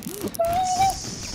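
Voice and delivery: giddy shrieking laugh; high squealing laugh. Real, not acted. Laughing at own kill cam.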